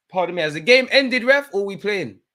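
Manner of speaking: high, loud mock-polite jab at the ref; sarcastic. Real, not acted. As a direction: needle the ref with fake politeness. Sarcastic call to the referee.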